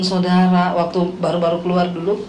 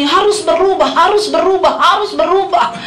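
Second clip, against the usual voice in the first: mimicked nagging preacher; 8 semitones higher than usual. Mimics preachers repeating a demand.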